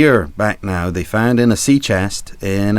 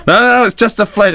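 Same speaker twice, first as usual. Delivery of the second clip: high, louder hurried protest; mock-alarmed sailor's insistence; urgent crew reassurance; 12 semitones higher, 5 dB louder than usual. Crew over their shot captain, high mock-urgent reassurance.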